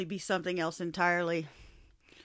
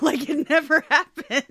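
loud, slow words broken by laughter; laughing; 9 semitones higher, 13 dB louder, slower than usual. Real, not acted. Laughing through a joke.

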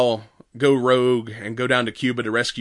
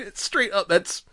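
normal talking voice

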